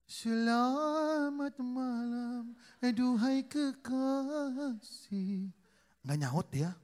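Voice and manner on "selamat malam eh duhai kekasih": very slow, higher crooning lover; mock-romantic drawn-out greeting to his wife. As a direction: draw it out like a corny romeo. Husband greets wife theatrically.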